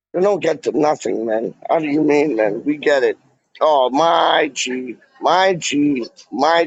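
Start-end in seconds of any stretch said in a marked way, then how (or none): none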